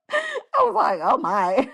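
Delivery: "oh my" through real giggles; laughing. Real, not acted. Mom-to-be laughing at nursing noises, giggling.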